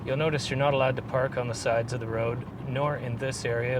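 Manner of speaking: ordinary talk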